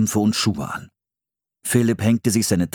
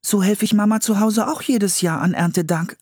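put-on high young girl, faster at the end; girl voice, protesting; 12 semitones higher than usual. A narrator's reading. Anne refuses to collect old clams, high and upset. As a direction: she can't bear to kill them.

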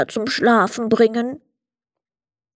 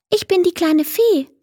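much higher, friendly fairy voice; friendly little fairy; 10 semitones higher than usual. A narrator's reading. Little fairy greets the boy Emil on his blanket, high and friendly. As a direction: introduce yourself as a tiny kind fairy. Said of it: "meinte sie freundlich".

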